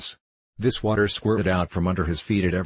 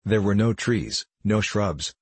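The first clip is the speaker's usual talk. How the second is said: ordinary delivery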